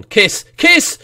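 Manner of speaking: rising shouted plea; will the ball in; urging the shot. Real, not acted. Gamer urging his striker's shot, rising shout.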